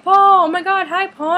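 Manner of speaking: high, loud fangirl call; excited fan greeting. Retold or imitated. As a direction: call out to your idol, high and thrilled. Fan calling out, retold.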